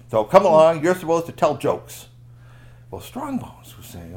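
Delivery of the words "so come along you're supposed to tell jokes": loud command. Acted. Guard orders loudly.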